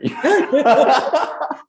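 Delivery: loud, high burst of laughter; real high-pitched laugh. Real, not acted. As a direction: laugh out loud at your own joke. Amused by own gangster remark.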